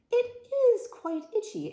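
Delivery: very high, loud, slow reply; proper-speaking rascal. Acted. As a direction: draw it out high, in un-piratelike formality. Rascal answers in un-pirate speech.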